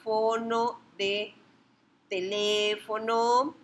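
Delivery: "fono de teléfono" drawn out slowly; slow emphasis. Shown as a demonstration. Teacher linking "fono" to "teléfono", slow and deliberate. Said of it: "si les suena".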